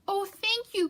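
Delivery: high, thankful burst; bunny thanking friends, high and happy; grateful bunny cry. Acted. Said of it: "cried Rabbit".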